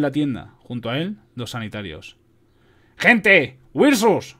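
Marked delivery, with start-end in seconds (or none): 3.0-4.4 high, loud cry of alarm; panicked battlefield shout; shouting soldier. A narrator's reading. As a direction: shout the warning to everyone in the tent. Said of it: "gritó el soldado".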